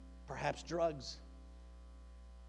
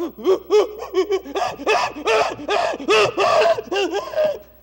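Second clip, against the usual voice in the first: big shouting laugh; loud laugh; 11 semitones higher, 13 dB louder than usual. Real, not acted. Laughing at the monkey.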